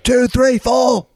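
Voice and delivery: high, loud shouted count-in; shouted count-in before the song. Retold or imitated. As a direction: shout the count-in like a punk frontman. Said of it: "it'd be like".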